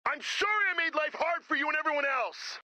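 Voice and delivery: high, shouted sorry. Acted. Guitarist finally apologizes to his bandmate, shouting.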